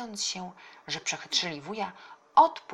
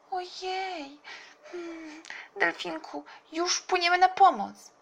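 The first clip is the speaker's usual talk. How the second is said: put-on high child voice, growing louder; worried little girl; 13 semitones higher, 6 dB louder, slower than usual. A narrator's reading. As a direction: start concerned, build to eager promise. Tola answers the frightened dolphin. (wysoki głos przejętej dziewczynki)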